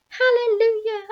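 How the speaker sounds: high, loud whoop of joy; exultant shout. A narrator's reading. Aunt cheering the giant peach, jubilant high shout. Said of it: "Aunt Spiker shouted".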